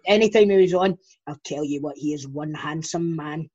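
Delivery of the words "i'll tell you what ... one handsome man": a hint of a mum swooning over a footballer; quiet mock swoon from a mum; lightly voiced swooning mum. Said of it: "She would say".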